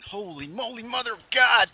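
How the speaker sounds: panicked high yell; scared shout. Real, not acted. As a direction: shriek it in fright. Fleeing creepers.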